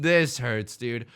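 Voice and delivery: normal talking voice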